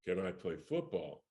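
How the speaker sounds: low, soft, scoffing question; cocky echo. Retold or imitated. Kid echoing agent's question, cocky assurance. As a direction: drop low as if the answer is obvious.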